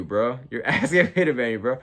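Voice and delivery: normal talking voice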